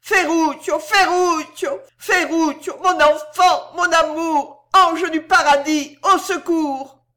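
loud, high-pitched screams of despair; anguished grandmother crying out; desperate cry. A narrator's reading. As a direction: call out with all your strength, desperate for help. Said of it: "elle cria de toutes ses forces avec désespoir".

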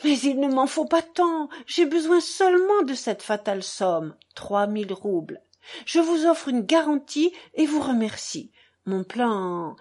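ordinary delivery, nothing marked

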